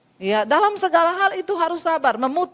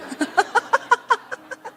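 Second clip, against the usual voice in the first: high burst of laughter; 10 semitones higher than usual. Real, not acted. Laughing at own joke.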